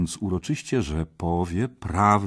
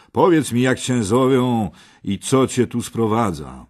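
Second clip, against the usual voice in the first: raised, higher questioning call; 4 semitones higher, 6 dB louder than usual. A narrator's reading. Kej rides closer to question the knight, raised calling voice. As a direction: demand an answer from the stranger. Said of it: "zakrzyknął Kej".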